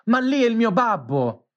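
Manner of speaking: high, desperate cry of alarm; piercing cry. A narrator's reading. Pinocchio spotting his father at sea, high desperate cry. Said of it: "cacciò un urlo acutissimo gridando".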